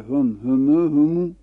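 "هم", then "همه", then "همو" with a long rising u; teacher lists "هم", "همه", "همو"; three forms. Shown as a demonstration. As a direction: step through "هم", "همه", "همو" by changing the final vowel. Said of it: "هم سه وضع".

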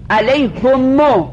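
علیهمو slowly, h and mim both with damma; slow demonstration. Shown as a demonstration. Teacher modelling the double damma, slowly. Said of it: "های مضمومه میم مضمومه".

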